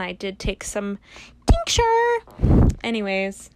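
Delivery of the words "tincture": squeaky. Real, not acted. Sudden squeak mid sentence.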